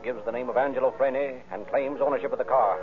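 ordinary talk

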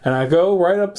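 ordinary talk

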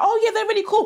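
high mock compliment. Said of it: "they'll be like".

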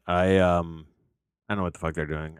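ordinary delivery

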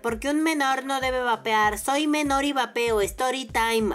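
high, slow, mocking clickbait youtuber; mock youtuber title voice. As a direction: parody a teen vlogger reading his titles. Mocking silly YouTube video titles. (voz burlona de youtuber adolescente)

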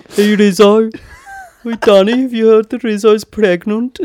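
high, loud, hammed-up Brummie; mock Brummie calling out; Brummy accent. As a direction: shout it like a bad Birmingham Grease cast. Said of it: "That was bad, actually".

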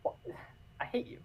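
breathy sigh then whisper. Retold or imitated. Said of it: "I'm just kinda like".

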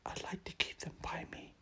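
soft breathy whisper; hushed whisper. A narrator's reading. Nan whispering to Harry about her robots. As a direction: keep it under your breath. Nan's quiet lines.

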